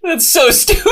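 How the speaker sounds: squealing high laugh-talk; can barely get it out for laughing; high giggly squeal. Real, not acted.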